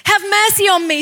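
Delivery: high, insistent shout; urgent, high-pitched plea. Acted. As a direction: call out persistently for mercy. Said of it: "he cried out all the more".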